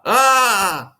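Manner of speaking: high, slow mock wail; mocking cry for help. Narrator mocks Fortunato's pleas from the tomb, hammed-up wail. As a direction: ham up the victim's pleas to mock him. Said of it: "repeat back to him and make fun".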